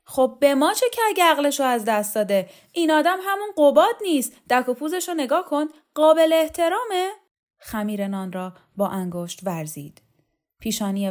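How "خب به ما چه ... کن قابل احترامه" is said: high, loud, indignant outburst; a proud official flares up, much higher and louder; flaring up. Acted. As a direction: bristle and snap back at them. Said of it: "قهرمان شوکت براق شد".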